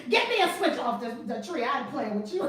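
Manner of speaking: mock stern grandma, half laughing; hammed-up grandma threat; threatening grandma. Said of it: "My grandmama would have beat you".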